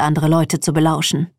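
ordinary delivery

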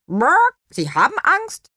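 high, loud hen. A narrator's reading. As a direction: state it like a bold hen. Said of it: "stellte Nofretete fest".

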